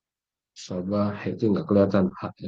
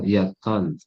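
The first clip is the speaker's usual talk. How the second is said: usual speaking voice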